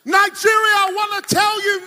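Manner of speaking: high, very loud call to the crowd; full-force preaching shout. Real, not acted. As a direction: cry out to a whole nation. Preacher calls out to the nation.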